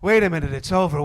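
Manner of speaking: ordinary delivery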